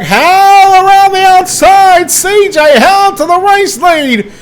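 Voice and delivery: fast, rising race call; live play-by-play. Real, not acted.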